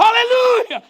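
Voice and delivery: crowd roar, high and loud; loud church cheer; shouted hallelujah. Retold or imitated. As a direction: burst out praising. Congregation shouting.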